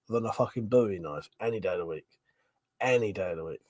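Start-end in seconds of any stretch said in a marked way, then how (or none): 0.1-2.1 fast, "Bowie" said as "boo-ee"; "Bowie" with an oo vowel, rushed; mocked boo-ee. Shown as a demonstration.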